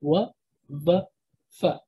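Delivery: W, V, F as "wuh", "vuh", "fuh"; W, V, F as bare sounds with pauses between. Shown as a demonstration. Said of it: "V eventually became an F sound".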